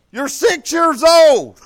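slow, loud, every word hammered; stern scolding. Retold or imitated. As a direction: lay down the law, word by word. Scolding grandson for pouting.

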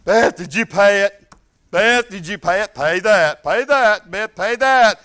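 insistent, loud nagging repeat; mock nagging wife; nagging loud quote. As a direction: keep pressing her to pay the bill. Wife nagging about a bill.

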